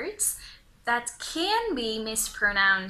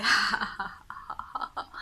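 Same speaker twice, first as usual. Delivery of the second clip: demonstrated laugh ha. Shown as a demonstration. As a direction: fake laugh showing the H. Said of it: "when you laugh, you say".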